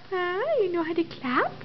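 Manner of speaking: ordinary talk, nothing marked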